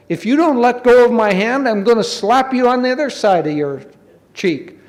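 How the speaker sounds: high, loud, indignant warning; heated mock threat. Stuck rabbit threatens the doll, loud and indignant. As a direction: raise your voice in storybook outrage.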